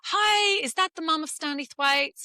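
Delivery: doctor calling the mum, chirpy phone voice; bright phone greeting. Retold or imitated. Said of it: "she said".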